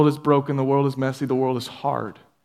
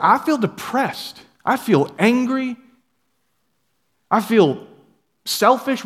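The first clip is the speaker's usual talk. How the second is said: higher, slow weary complaint; mock morning gloom; 8 semitones higher, slower than usual. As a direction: groan out the bad feelings. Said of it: "Anybody wake up in the morning like".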